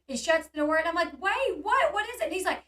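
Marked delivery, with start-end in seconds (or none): 1.2-2.2 high, startled questioning; retold burst of alarm, pitched well up. Woman to Sean as he jumps in, alarmed. Retelling her alarmed reaction.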